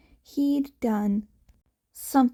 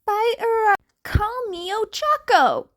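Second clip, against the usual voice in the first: Uraraka, eager; 11 semitones higher, 6 dB louder than usual. Acted. Uraraka interrupts.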